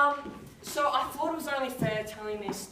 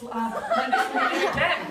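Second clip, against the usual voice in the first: laughing; 7 semitones higher, 7 dB louder than usual. Retold or imitated. Store sign quoted, laughing.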